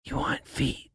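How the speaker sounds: hushed whisper, imitating a masseuse; very soft, secretive mock-masseuse whisper; whispered in the ear. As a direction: whisper a discreet offer close to someone's ear. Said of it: "She goes into my ear".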